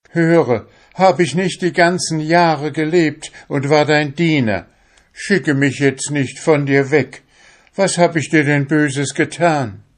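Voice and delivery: high loud pleading cry; soul crying out. Acted. The soul pleads loudly with the fisherman. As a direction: cry out and beg not to be sent away. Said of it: "schrie laut".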